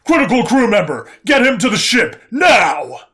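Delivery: shouting drill sergeant, high and loud. Acted. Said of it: "sounded like an actual drill sergeant".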